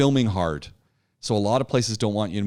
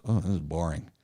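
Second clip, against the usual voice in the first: low, soft bored groan; imitated boredom; 7 semitones lower, 5 dB quieter than usual. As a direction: mimic an audience losing interest, low and quiet. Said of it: "everyone kinda goes".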